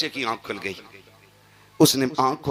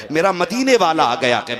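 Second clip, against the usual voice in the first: higher, louder joyful repeat; मदीने stretched, higher and louder; rising joy; 5 semitones higher, 7 dB louder than usual. Acted. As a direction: lift it with joy the second time. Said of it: "repeat, raised".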